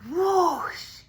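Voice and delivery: a sudden loud high whoosh; loud, high sound effect. A narrator's reading. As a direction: burst out with the splash. Muskrat bursts up.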